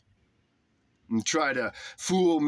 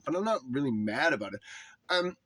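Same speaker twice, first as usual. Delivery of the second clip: normal talking voice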